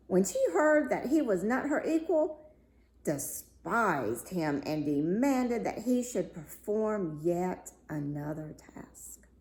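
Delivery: usual speaking voice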